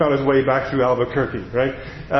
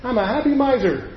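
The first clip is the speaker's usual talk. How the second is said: much higher, tiny cartoon duck voice; high-pitched cartoon duck; little high-pitched voice; 9 semitones higher than usual. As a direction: squeak it like a tiny contented miser. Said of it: "you hear Daffy Duck go".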